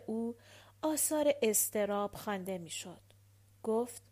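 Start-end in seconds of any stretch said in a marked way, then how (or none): none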